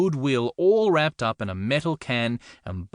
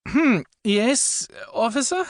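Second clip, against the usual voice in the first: groggy high reply; 13 semitones higher, 3 dB louder, slower than usual. Acted. Woken by officer at car window.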